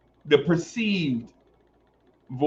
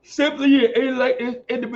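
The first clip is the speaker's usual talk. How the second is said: much higher, quick, affected show-off voice; high put-on voice; 12 semitones higher, 7 dB louder than usual. Streamer playfully announcing his own brilliance in a high affected voice. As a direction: put on a lofty voice to announce himself.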